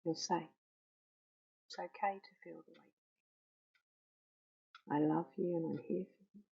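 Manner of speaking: very soft, near-whispered comfort; gentle reassurance. Shown as a demonstration. Soft words of comfort spoken to the cushion. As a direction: cradle the words, hushed and loving. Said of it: "you just gently say".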